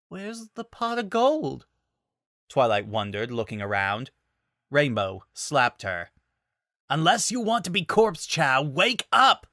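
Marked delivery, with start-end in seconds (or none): 0.1-1.7 dazed wonder. Acted. Twilight still dazed. 6.9-9.5 much higher, louder sharp order; snapping. Acted. Rainbow Dash to dazed Twilight, snapping. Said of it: "Rainbow snapped at her".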